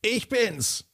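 very high, slow squeaky little cup voice; high squeaky talking cup calling. A narrator's reading. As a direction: call out as a tiny demanding cup. Cup voice answers.

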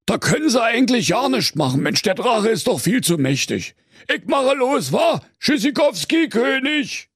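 high-pitched Berlin cop; high, hurried brush-off; commissioner bailing out. A narrator's reading. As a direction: excuse yourself and make a cheerful exit.

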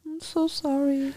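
soft, slow commiseration; mock sympathy. Co-host commiserates with the overlooked son, softly mock-sorry. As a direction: drag out a hushed apology, playfully pitying.

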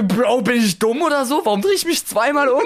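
retold bewilderment, breaking into amusement; mock exasperated disbelief. Podcaster questioning himself after turning round, mock exasperated disbelief. Acts out own baffled thought.

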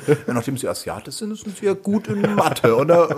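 high, slow, mock-polite interviewer. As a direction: put on a prim job interviewer. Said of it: "Ich stelle mir direkt so ein Bewerbungsgespräch vor".

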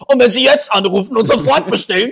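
high, loud teleshopping pitch; infomercial. Retold or imitated. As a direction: shout the offer like a TV salesman. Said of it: "Und dann kommt noch dieses". (laute, hohe Teleshopping-Stimme)

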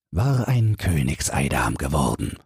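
plain reading voice

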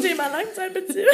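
high, quick words through laughter; laughing. Real, not acted. Echoing friend's jibe about her relationship, laughing. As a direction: squeeze it out while cracking up.

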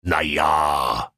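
mild kingly hesitation; lightly voiced king. King continues, lightly voiced. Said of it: "fuhr der König fort".

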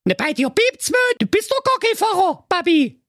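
sehr hohe, schnippische Mädchenstimme; verwöhnte Prinzessin. Acted. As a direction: zickige Teenie-Prinzessin. Prinzessin widerspricht. (die Prinzessin lehnt den Freier spöttisch ab)

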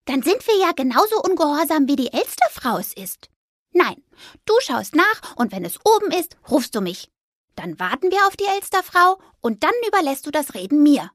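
high, bright mouse voice; squeaky determined mouse; little mouse. A narrator's reading. Little mouse Fipper insisting to Anton.

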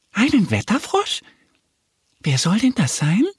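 high-pitched questioning duck voice; curious little duck. A narrator's reading. Little duck asks her bear friend about the weather frog, curious. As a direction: ask it high and puzzled, rising at the end.

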